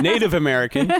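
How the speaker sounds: laughing mock-American, high and loud; hammed-up American accent through laughter; put-on American accent. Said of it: "gonna be an American".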